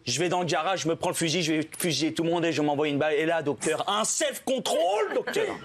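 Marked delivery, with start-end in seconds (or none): 3.9-5.6 high, slow, drawn-out squeak of self-control; a strained falsetto self-control; mock strained self-control. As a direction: stretch it out high as if barely holding on.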